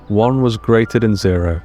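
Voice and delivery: plain reading voice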